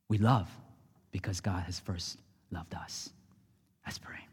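hushed unvoiced whisper. Real, not acted. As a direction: breathe it out as a closing secret. Closing line whispered.

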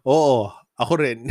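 can't hold back the laugh; cracking up at the punchline; laughing quote. Retold or imitated. Retelling the man's reply, laughing.

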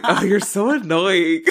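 put-on loud, drawn-out teenage complaint; exasperated. Teen driver complaining to mother, exasperated.

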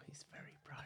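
hushed, secretive whisper. Acted. Joking about boyfriend's privacy.

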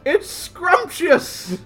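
hammy ad mascot. Acted. Mascot slogan voice.

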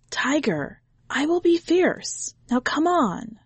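put-on high eager boy voice; a little boy vowing; child. A narrator's reading.

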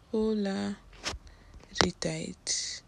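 very slow "Polariteit" while reading; slow drawn out. Real, not acted. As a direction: stretch "Polariteit" out slowly. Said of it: "let me see".

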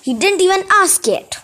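understated storybook scream, pitch jumping up; mock screaming. A narrator's reading. Sisters silencing Tempest as they pummel her, mild mock scream.

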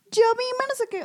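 mock child voice; girl answering. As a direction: answer like a proud little girl. Young self answering mother.

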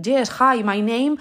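exaggerated Spanish accent on English words; Spanish accent in English, mocking. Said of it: "y hablan así".

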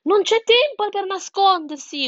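high, loud urgent yell; yelling chick. A narrator's reading. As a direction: shout down the idea urgently. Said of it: "urlò Sansone". (pulcino che urla, voce alta)

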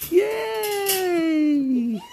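loud, very high drawn-out squeal; long high-pitched happy shout; squealed cheer. Real, not acted. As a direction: cheer the dog on in a piercing high voice. Cheering on the new dog.

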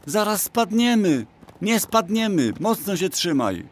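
very high-pitched alarmed cries; Arbuz crying out. A narrator's reading. Arbuz to Pchełka on the galloping horse, high panicked cry. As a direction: squeal it out while bouncing on horseback. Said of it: "krzyknął Arbuz".

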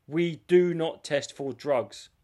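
very slow, deliberate contract wording; big bold letters. Retold or imitated. Quoting a fight contract's bold print, slow and emphatic. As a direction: spell it out slowly, word by word. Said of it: "In big bold letters".

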